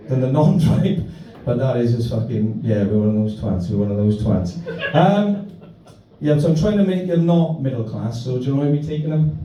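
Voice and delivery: ordinary delivery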